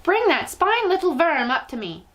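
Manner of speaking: high, scornful witch order; witch's shout. A narrator's reading. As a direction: spit out the insult. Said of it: "shouted the Grand High Witch".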